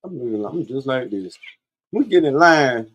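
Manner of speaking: ordinary talk, nothing marked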